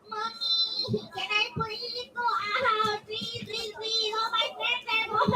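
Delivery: high, soft, drawn-out pleading whine; put-on kiddie begging, high and quiet; whining, begging child. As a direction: whine and beg like a little kid. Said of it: "if you're gonna be whining".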